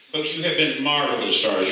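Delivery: usual speaking voice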